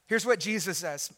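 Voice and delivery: ordinary talk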